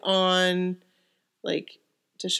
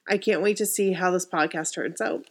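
ordinary delivery

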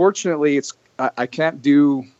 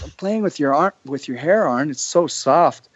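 imitating Rosa; 6 semitones higher than usual. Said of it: "She goes".

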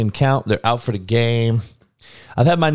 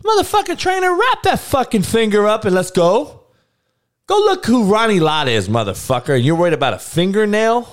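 mock angry rant; 8 semitones higher than usual. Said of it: "I'm like".

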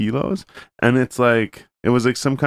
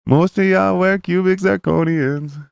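higher, slow put-on rapper voice; slow, raised mock rapper delivery; 8 semitones higher, slower than usual. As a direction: drawl the lyric like the artist would. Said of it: "And then he's like".

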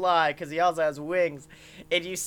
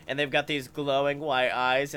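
ordinary delivery

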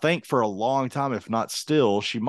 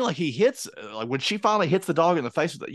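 ordinary talk

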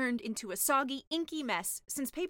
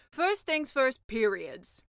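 louder, serious sea witch; 7 dB louder, much slower than usual. Acted. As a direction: break the news as the witch. Sea witch starts lesson.

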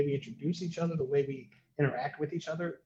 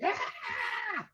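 death cry. Retold or imitated. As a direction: imitate a child's terrified shriek. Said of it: "this insane scream, like a death scream".

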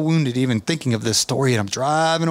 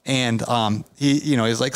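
ordinary delivery

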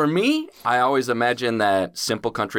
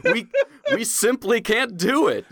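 laughing shout; 10 semitones higher than usual. Pretend.

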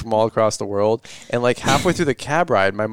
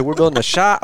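mock country accent; 4 semitones higher than usual. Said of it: "it's like Perry County coming".

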